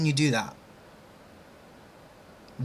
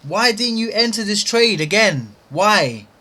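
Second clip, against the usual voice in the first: loud, higher frustrated question; exasperated demand; 5 semitones higher, 4 dB louder than usual. Real, not acted. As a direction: demand an answer from yourself. Trader scolding himself over missed trade.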